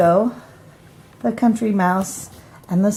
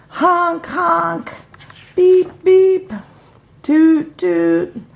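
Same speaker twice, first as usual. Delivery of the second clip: car horns; 8 semitones higher, much slower than usual. Acted. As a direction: honk like city traffic. Imitating city traffic horns.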